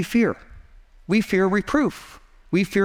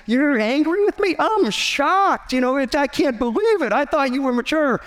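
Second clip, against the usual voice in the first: a hint of God in mock disbelief, pitched higher; mock shocked God; 7 semitones higher than usual. Pastor voicing God as shocked by our anger, mock surprise. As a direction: playfully voice a shocked, disappointed God. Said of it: "he sits back and said".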